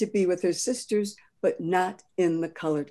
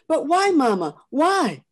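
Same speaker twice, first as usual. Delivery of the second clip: put-on a child's cry of why, much higher and louder; upset girl asking why; 9 semitones higher, 7 dB louder than usual. Tearful Patsy begging her mother for a reason, high and loud. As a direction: plead with mama, pitched way up.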